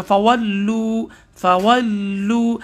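فَوَلُّوا very slow, doubled lam held and pressed; teacher drilling the shadda slowly; slow demo. Shown as a demonstration. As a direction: pause on the lam of فَوَلُّوا then release firmly. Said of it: "لام پر تھوڑا سا رک کر سختی سے".

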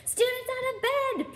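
very high tattling holler; Peeves, bellowing. A narrator's reading. Peeves alerting Filch to students, high bellow. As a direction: shriek the alarm in a piercing high pitch. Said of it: "Peeves bellowed".

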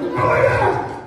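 very high, blaring horn imitation; imitated air horn. Preacher mimics a scooter's semi-truck horn, imitated air horn. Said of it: "semi-truck air horns on it".

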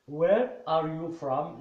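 "Where are you from?" said slowly, every word clear; slow classroom model; slow model question. Shown as a demonstration. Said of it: "teacher modelling the question".

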